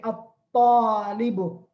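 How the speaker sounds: slow at-talibu with no l and a long doubled t. Shown as a demonstration. Teacher repeating the word with shadda, doubled ta. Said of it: "will be having shadda".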